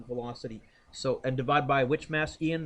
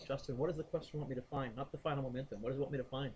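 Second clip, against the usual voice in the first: quiet; 8 dB quieter, faster than usual. Real, not acted. Teacher quietly asks a student.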